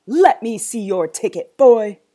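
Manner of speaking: very loud, stern order; angry demand. Acted. Zoo owner checking the boy, angry demand. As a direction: demand it forcefully.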